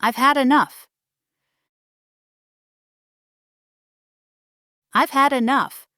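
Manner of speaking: strong without shouting; controlled anger. Shown as a demonstration. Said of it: "It should be strong, but not loud".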